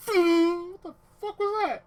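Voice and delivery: loud, high-pitched whoosh and exclamation; mock startled onlooker. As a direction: yelp it as a stunned bystander. Whoosh, then startled onlookers' reaction.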